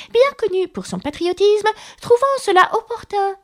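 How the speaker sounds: high, pompous mouse voice; important mouse lecturing. A narrator's reading. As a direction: recite dry history as a self-important mouse. Mouse lectures dry history.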